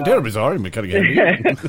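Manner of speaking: a hammed-up toff apologising; mock posh British.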